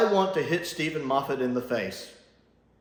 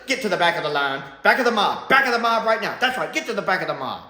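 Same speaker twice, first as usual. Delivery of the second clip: raised, louder mock Dalek command; huffy Dalek order; 8 semitones higher, 6 dB louder, faster than usual. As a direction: order the doubter to the back. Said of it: "he's just personally offended by it".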